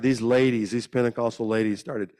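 usual speaking voice

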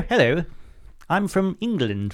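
mock posh English accent; posh London accent. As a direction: put on a posh London voice, drawing it out. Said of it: "They just mean posh London".

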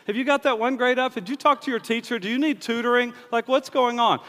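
a hint of an exasperated mom grilling her kid. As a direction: rattle off questions like a nagging mom. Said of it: "your mom looks at you and she says".

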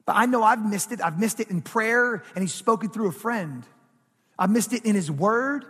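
ordinary talk

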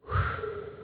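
sigh of relief. Retold or imitated. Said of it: "sigh of relief".

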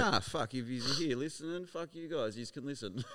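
high, laughing mock insult; teasing laugh. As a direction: tease them through a laugh.

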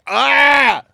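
loud, high "aah" cry; real yelp. Real, not acted. Host cries out mid-banter, a loud high yelp.

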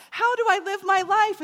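high, loud, over-eager plea; bouncy yellow person. Acted. Yellow follower asking a commanding leader, high and loud. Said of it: "and they're like".

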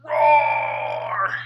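primal roar. Shown as a demonstration. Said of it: "That's my animal primal nature sound roaring".

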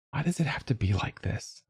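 very soft, high, plaintive near-whisper; whispered question. A narrator's reading. Child asking dad why, plaintive whisper. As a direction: ask it small and hushed. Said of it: "I whispered".

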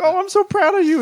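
high mock-proud wifely voice; imitated wife. Wife praising husband for the clean shirt, high imitation. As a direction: coo it way up high. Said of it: "And she's like".

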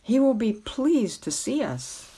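plain reading voice

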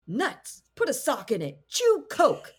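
lightly voiced cheeky creature, high and rising; high-pitched mongoose. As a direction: toss off the insults in a mock squeaky mongoose voice. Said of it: "always in a high-pitched voice".

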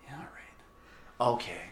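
slow hushed whisper. Acted. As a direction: murmur agreement under your breath. Secret plotting in the bathroom.

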